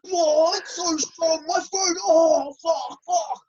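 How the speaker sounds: high, slow disgusted reaction; disgusted friend. Retold or imitated. Snowy sculls, reacts.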